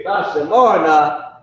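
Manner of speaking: "Tha shamarna" slow and loud; Hebrew phrase. Shown as a demonstration. Teacher repeating the phrase for the students, slow. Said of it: "That's how you would say it".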